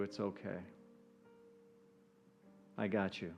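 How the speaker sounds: softly reassure, as if half whispering; soft whispering presence. Acted. A presence comforting the listener who is held, softly half-whispered. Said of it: "that presence is whispering to you".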